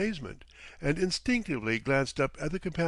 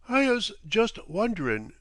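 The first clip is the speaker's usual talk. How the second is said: Cockney captain; 9 semitones higher, 5 dB louder than usual. A narrator's reading.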